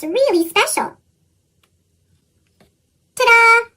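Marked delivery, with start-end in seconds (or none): 3.1-3.7 loud, high proud reveal. Acted. Child unveiling her mailbox to the class, proud and loud. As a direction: show off the mailbox with a flourish.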